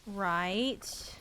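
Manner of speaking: ordinary delivery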